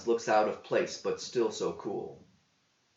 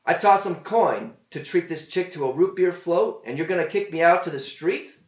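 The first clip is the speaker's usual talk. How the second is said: higher, louder protest; indignant John; 6 semitones higher, 6 dB louder than usual. A narrator's reading. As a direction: talk back to the cook.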